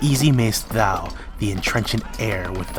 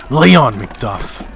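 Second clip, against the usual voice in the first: a much louder cry, throwing down the gauntlet; defiant battle cry; 3 semitones higher, 8 dB louder than usual. Acted. Macbeth daring Macduff to fight, loud defiant cry. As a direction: roar the dare at him.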